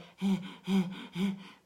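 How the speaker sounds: rasping breaths like sawing wood; rhythmic mimicked leopard rasp; imitated leopard call. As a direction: huff out a leopard's sawing sound. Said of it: "sounded like somebody was sawing wood".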